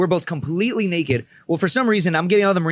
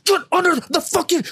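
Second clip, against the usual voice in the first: very high, panicky mock yell; hammed-up desperate command; retold frantic order; 14 semitones higher than usual. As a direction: order her as if about to be caught. Retold order escalates, scaring her.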